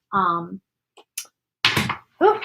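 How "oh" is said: ordinary talk, nothing marked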